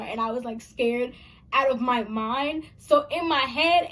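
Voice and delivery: usual speaking voice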